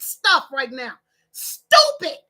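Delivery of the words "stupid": one high, loud, punched word; exasperated outburst. Real, not acted. Scolding online squabblers, high loud exasperation. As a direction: throw it out high and hard.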